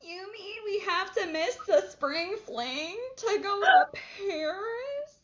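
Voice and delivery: put-on high, whining teen girl, slowing to a wail on Paris; a hammed-up bratty teen complaining; whiny preteen. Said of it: "they're like".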